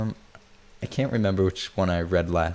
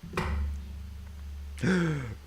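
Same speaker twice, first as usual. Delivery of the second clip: breathless, high gasp of delight; a soft, awed intake of breath; surprised gasp; 8 semitones higher, 6 dB quieter than usual. Real, not acted. Streamer seeing the finished piece, gasping in awe.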